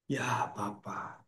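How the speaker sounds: very slow, soft, low address; hushed prayer. Acted. Jesus praying to the Father, hushed and slow. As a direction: pray it softly, drawing out each word. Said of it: "Tuhan Yesus berdoa".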